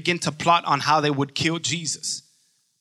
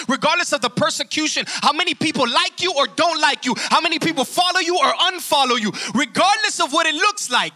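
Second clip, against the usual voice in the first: higher, driving sermon voice; impassioned preaching; 8 semitones higher than usual. Real, not acted. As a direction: build with urgent conviction. Preaching at full force.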